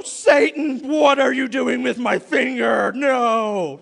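loud, far-off voice shouting at Satan; hammed-up accusation, loud; mock indignant shout. Man blaming Satan for his own finger, mock shout. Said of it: "look at Satan and go".

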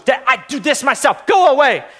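mock child protest, punching the end; imitating four-year-old. Daughter sending dad away at gymnastics, mock-indignant. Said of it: "She goes".

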